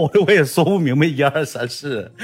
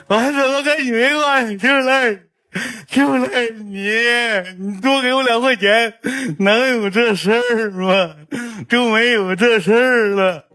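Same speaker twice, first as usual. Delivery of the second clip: put-on high child voice blaming and sulking; whiny kid; 10 semitones higher than usual. Grandson whining at grandpa that it's his fault, high voice. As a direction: whine it like a spoiled grandson.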